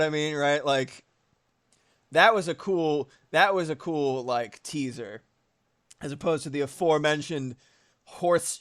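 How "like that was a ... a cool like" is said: ordinary talk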